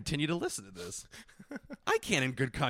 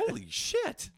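mock shock; slower than usual. Reacting to retold drug pickup.

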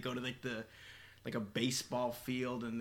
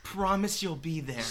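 anime voice; 4 semitones higher, 4 dB louder than usual. Retold or imitated. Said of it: "like an anime".

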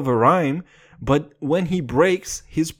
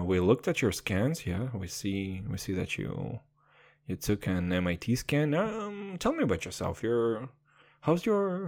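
softer, lower doctor's bedside manner; mock doctor; 5 semitones lower, 6 dB quieter than usual. Pretend doctor addressing [name], soft bedside voice. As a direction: play a gentle doctor breaking news.

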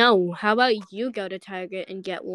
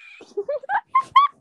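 very high, loud laugh; shrieking laugh; 5 dB louder than usual. Real, not acted. Laughs after the arrest.

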